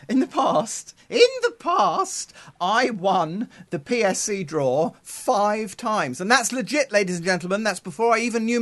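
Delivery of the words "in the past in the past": laughing. Real, not acted. Laughs while restarting his story.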